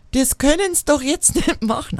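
high-pitched protest in Franconian dialect; Franconian mock bellow. Outraged customer at the closed till, imitated Franconian bellow. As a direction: bellow it over in broad Franconian. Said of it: "brüllt drüber, ... auf Fränkisch".

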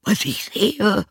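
high, slow donkey voice; donkey character. Acted. Donkey peering in at the window, high slow answer. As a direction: echo the question high and drawn out. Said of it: "antwortete der Esel".

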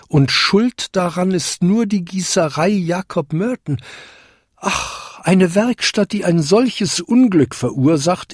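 höher, klagender alter Jakob; Jakob bestürzt. A narrator's reading. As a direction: ein leicht gespielter Jakob voller Sorge. (Jakob denkt verzweifelt nach, höher und klagend)

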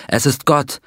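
a contained cry of revelation; understated narrated cry. A narrator's reading. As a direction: exclaim it with restrained awe. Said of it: "schrie er".

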